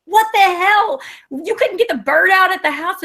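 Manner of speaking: high, loud disbelieving what the hell; incredulous coworkers. Retold or imitated. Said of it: "And so they were like".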